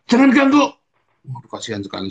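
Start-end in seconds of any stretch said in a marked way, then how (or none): 0.1-0.6 much higher, loud shout; angry snap. Retold or imitated. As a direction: yell it to be left alone. Angry father voiced in story.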